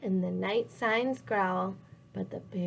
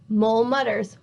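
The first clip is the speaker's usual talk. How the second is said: usual speaking voice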